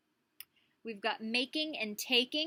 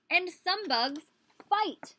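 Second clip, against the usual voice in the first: louder, higher and slow, punching fight; dramatic read-aloud; 9 semitones higher, 9 dB louder, much slower than usual. Acted. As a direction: read it big and bold for the kids. Reading book aloud, dramatic.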